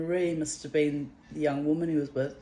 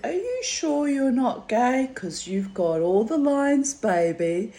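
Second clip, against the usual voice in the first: teasing flirt; 6 semitones higher, 5 dB louder than usual. Retold or imitated. Her teasing flirty reply.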